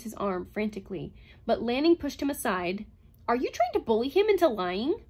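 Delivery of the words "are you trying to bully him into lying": sharp angry challenge. A narrator's reading. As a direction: push back hard at him. Heated quarrel between scientists.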